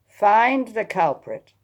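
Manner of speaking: slow command. Acted. Lady Wem commanding Attilia.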